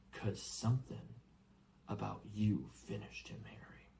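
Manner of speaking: hushed, breathless whisper; awed whisper. Acted. Hagrid confiding to Harry, hushed whisper. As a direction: drop to a secret whisper.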